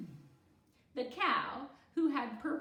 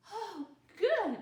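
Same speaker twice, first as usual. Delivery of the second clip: put-on relieved girl; 11 semitones higher, 9 dB louder than usual. A narrator's reading. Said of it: "Kiko said".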